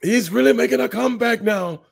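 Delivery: high, excited ringside call; wrestling commentator voice. Retold or imitated. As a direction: hype the comeback like live TV. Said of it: "a wrestling commentator's voice".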